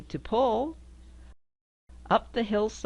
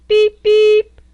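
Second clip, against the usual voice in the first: much higher, loud tinny beeps; high squeaky beep; 17 dB louder than usual. Acted. Reading to a child, beeping high and loud like a horn.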